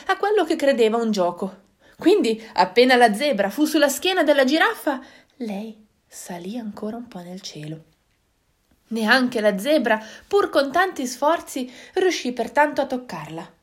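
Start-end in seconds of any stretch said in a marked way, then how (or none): 5.4-7.8 hushed whisper, much softer. A narrator's reading. Narrator whispers as the moon slips away. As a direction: whisper it like a secret.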